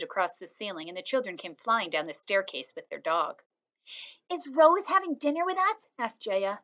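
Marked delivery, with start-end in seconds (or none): 4.3-5.9 put-on high, light little girl asking; child voice. A narrator's reading. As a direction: voice a young girl, eager. Child character Jaya asks.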